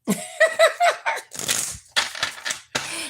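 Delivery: long airy high laugh that falls away. Real, not acted. Laughing at a viewer comment, high and airy. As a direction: burst into a breathy laugh.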